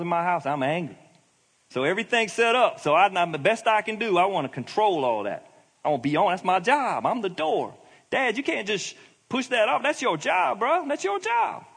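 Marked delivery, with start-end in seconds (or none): 1.7-11.6 high-pitched, urgent exhortation; fired-up preacher urging dads; impassioned preaching. Real, not acted. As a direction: press the charge on the fathers, pitch climbing high.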